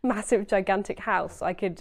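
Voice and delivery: ordinary delivery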